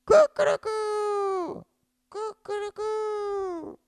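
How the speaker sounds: very high crowing cry; shrill cock-a-doodle crowing; rooster crowing. Acted. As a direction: crow it out like a proud rooster. Rooster crows to show his voice.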